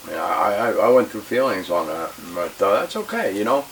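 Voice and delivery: usual speaking voice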